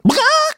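sudden loud, high bawk; cut-off squawk of alarm; startled hen squawk. Acted. Storyteller voicing chicken caught by wildcat, startled squawk.

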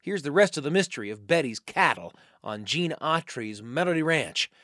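normal talking voice

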